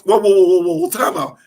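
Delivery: loud, rapid-fire whoas; startled interruption. Real, not acted. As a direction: cut in to halt the conversation in surprise. Halting the friend's surprise news.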